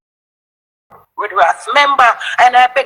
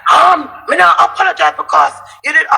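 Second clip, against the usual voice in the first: husband's shout; 7 semitones higher than usual. Retold or imitated. Husband shouting back at his wife's demand. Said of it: "You know what my husband said to me?".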